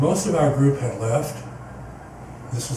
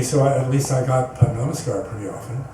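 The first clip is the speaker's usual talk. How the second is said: ordinary talk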